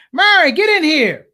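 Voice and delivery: loud high call to the next room. Retold or imitated. Father calling wife into the room, loud and high. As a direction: yell for his wife across the house.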